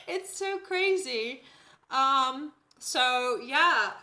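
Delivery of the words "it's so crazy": slow, laughing it out; amused, laughing disbelief. Real, not acted. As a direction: say it through real laughter. Laughing about her Dutch accent.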